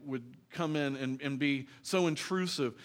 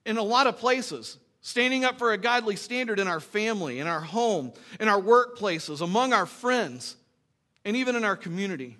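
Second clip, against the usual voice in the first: loud, raised preaching voice, each phrase hammered; full-force preaching; 7 semitones higher, 7 dB louder than usual. Acted. Pastor rousing his congregation, loud full-force preaching. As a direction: preach it out to the whole room.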